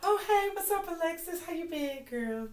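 high, soft cheery greeting; bright phone greeting. Retold or imitated. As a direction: sweeten it up for the phone. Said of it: "another person would call".

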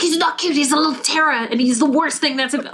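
put-on high, hurried child's complaint; protesting sibling. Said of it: "And he's like".

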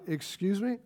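mock-baffled excuse me; incredulous. Gideon answering the angel, quietly incredulous. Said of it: "in modern language".

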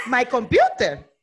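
loud, slow, drawn-out question; mock disbelief. Traveler answering a gringo asking for her computer, loudly baffled. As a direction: stretch the word in exaggerated bafflement.

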